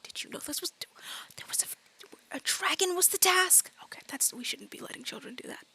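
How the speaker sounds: hushed, breathy, scandalised aside; whispering. Acted. Shocked teachers confer about the dragon task, whispering. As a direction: confer under your breath in disbelief. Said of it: "you just see whispering".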